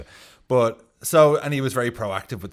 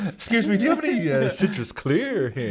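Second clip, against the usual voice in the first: awkward shopper voice; 8 semitones higher than usual. Retold or imitated. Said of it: "I'm certainly not gonna say".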